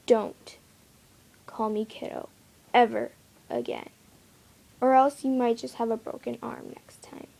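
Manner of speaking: ordinary delivery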